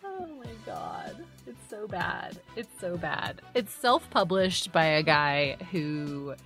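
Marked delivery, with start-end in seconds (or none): none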